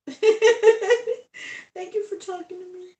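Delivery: high, loud, slow laughing thanks. Real, not acted. Streamer laughing thanks to viewers who finally chatted, high and loud.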